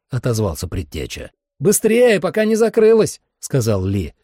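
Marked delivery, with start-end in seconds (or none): 1.6-3.2 loud, high-pitched urging; urgent shout. Acted. Lee urges haste before it closes, urgent shout. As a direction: hurry them on, voice way up.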